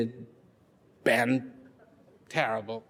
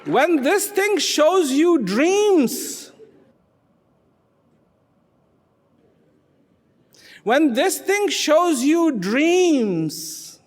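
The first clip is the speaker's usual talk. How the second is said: high, loud, drawn-out proclamation; emphatic preaching; 10 semitones higher, 6 dB louder, much slower than usual. Real, not acted. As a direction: stretch each word for a crowd. Speaker drives home the moral.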